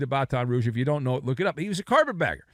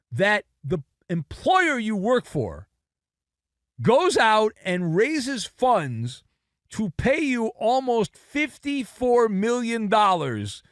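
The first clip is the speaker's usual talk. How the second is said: slow emphatic rant; 5 semitones higher, slower than usual. Real, not acted.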